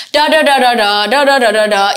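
wordless da-da-da showing a melody of speech; intonation tune demo. Shown as a demonstration. Teacher shows learners that intonation means tune, chanting da-da-da. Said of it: "intonation অর্থই হচ্ছে সুর".

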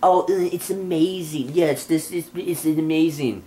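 mocking celebrity interview. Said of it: "anytime somebody interviews your boring".

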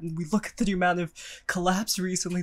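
ordinary talk, nothing marked

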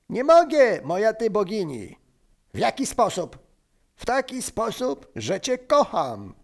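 high, hammed-up declaration of love; wyznanie miłosne. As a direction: call down adoringly in a high voice. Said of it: "to piękne wyznanie". (wysokie, teatralne wyznanie miłości)

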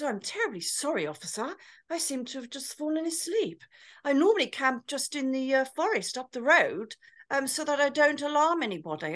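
exaggerated plummy posh English. Woken tramp apologises to the officer, very posh. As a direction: imitate an impeccably polite posh gent. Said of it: "It was as posh as a posh thing".

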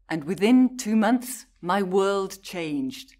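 ordinary talk, nothing marked

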